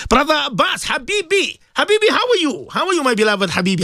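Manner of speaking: calling out to a dear friend; warm excited greeting. Real, not acted. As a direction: greet him high and bright with joy. Greeting caller warmly.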